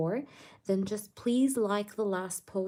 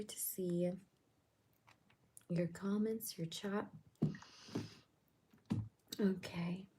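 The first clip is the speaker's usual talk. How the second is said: whispering; 10 dB quieter than usual. Real, not acted. Whispering at midnight.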